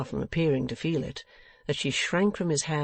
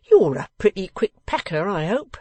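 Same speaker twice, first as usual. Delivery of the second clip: higher, louder, scornful send-off; scornful dismissal; 6 semitones higher, 8 dB louder than usual. Acted. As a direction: rudely send him packing. Boffin sends secretary packing.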